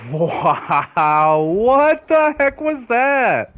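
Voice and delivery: stunned disbelief. Real, not acted. Player gaping at something crazy on screen, slow stunned cry. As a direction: stretch every word out in amazement. Strange event happens in game.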